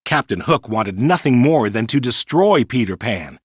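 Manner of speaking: ordinary delivery, nothing marked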